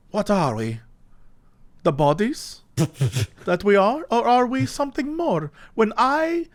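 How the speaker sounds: put-on a light British accent, slow and musing; lightly voiced elderly British philosopher pondering existence; old British man. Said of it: "the voice I did ... an old British man".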